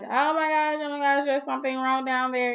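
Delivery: frantic oh my gosh, voice up and pushed; retold panic. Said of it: "I'm like".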